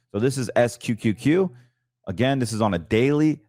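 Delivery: ordinary delivery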